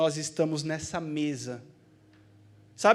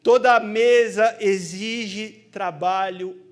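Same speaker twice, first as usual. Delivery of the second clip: preaching; 7 dB louder, slower than usual. Acted. Preaching refrain builds.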